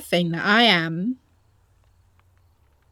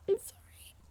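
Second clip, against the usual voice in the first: a high, much softer apology; tearful whisper; 12 semitones higher, 10 dB quieter than usual. Acted. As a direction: choke out a tiny sorry. Apologizing near tears.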